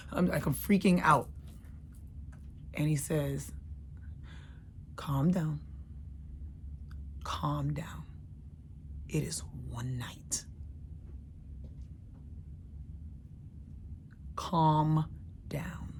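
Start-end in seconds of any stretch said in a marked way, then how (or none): 5.0-15.9 soothing friend. Retold or imitated. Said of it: "James Earl Jones voice".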